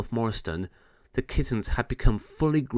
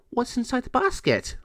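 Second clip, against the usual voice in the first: fairy voice; 13 semitones higher than usual. Acted. Fairy Natasha asks about basket.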